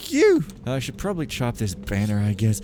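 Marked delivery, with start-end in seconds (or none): 0.1-0.4 squeaky. Real, not acted. Sudden squeaky thank you.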